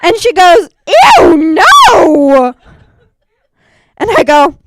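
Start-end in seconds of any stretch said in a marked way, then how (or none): 1.2-2.5 mock disgust. Friend recoiling in disgust.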